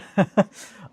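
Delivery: sudden big laugh; loud burst of laughter. Real, not acted. Laughing hard at the chat's joke. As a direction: crack up out loud. Laughs at dark ambient remark.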